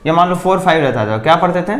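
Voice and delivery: usual speaking voice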